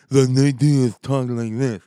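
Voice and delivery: loud, slurred lisping impression; Nate Diaz. Host making fun of a fighter's speech, lisping impression. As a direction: imitate a fat-lipped fighter, thick and loud. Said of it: "make fun of the fact".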